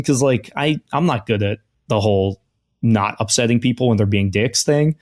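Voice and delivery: ordinary talk, nothing marked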